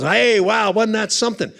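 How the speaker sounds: proud showman after a big entrance; mock excited.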